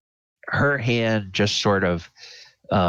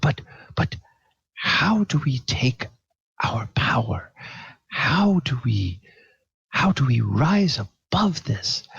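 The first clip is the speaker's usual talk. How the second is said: higher, partly whispered plea about rising above; higher-pitched earnest confession with whispered start; earnest young woman; 7 semitones higher than usual. Acted. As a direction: voice a heartfelt young woman confiding her past. GM holds a pleading character voice.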